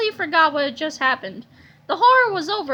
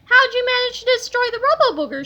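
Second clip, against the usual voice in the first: reporter voice; 8 semitones higher, 4 dB louder than usual. A narrator's reading. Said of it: "she asked".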